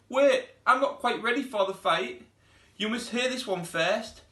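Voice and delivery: high, loud flustered knight voice; nervous knight. Acted. Knight stalling the dragon before the fight, high flustered voice.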